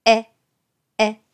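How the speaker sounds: "え" with lips pulled wide sideways; short, wide "え"; spread-lip e. Shown as a demonstration. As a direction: stretch the corners of the lips on "え". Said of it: "さらに左右に唇を引いて".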